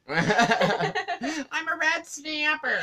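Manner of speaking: high, slow laughing words. Real, not acted. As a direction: giggle through the words. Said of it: "partner laughs".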